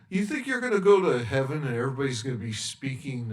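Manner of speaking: usual speaking voice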